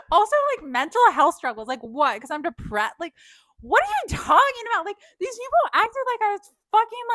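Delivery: loud, high, heated rant; outraged rant. Real, not acted. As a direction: rail against the unfairness loudly. Ranting at the accusation.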